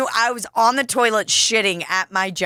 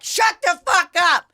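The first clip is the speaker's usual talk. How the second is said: higher, exasperated mock rant; a pitched-up, fed-up retort; mock outburst; 6 semitones higher than usual. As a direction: snap at the show-off, pitched up. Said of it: "that you're like".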